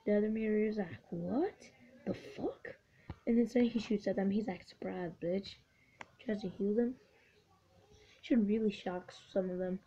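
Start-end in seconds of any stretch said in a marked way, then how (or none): 1.1-2.8 hushed mock disbelief; baffled voiced quote. Voicing the other baffled meteor. 4.7-5.6 soft, slightly lowered ambush taunt; understated little taunt; sly voiced quote. Voicing unit that shoots.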